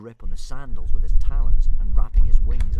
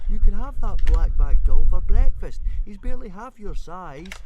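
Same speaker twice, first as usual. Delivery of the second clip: put-on coaxing boy voice; 11 semitones higher, 4 dB louder than usual. Fishlegs coaxing his dragon.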